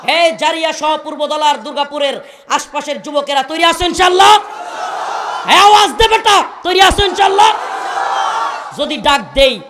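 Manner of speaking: full-force Bengali waz preaching; fiery sermon. Real, not acted. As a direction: rouse the crowd with booming verses. Fiery preaching with Quranic recitation.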